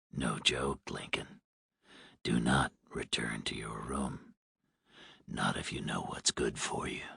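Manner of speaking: low hoarse smoker's whisper. A narrator's reading. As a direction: threaten in a rasping whisper. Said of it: "The man sounded hoarse, like a heavy smoker".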